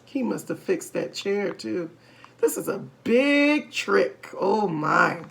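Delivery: usual speaking voice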